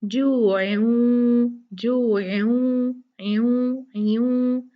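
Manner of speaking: जो ऐन held very slowly with a nasal hum into वाव; slow drawn joo-ain with nasal ghunna; nasal merge demo. Shown as a demonstration. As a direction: stretch ऐन and hum the nun into the w. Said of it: "तनवीन के नून साकिन को वाव से मिला देंगे".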